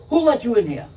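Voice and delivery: loud, high angry demand. Retold or imitated. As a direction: bark it at them. Waiter carrying on.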